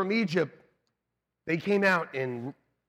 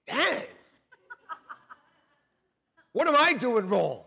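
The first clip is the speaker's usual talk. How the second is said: envious grumble; 6 semitones higher, 6 dB louder than usual. Retold or imitated. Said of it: "I'm thinking".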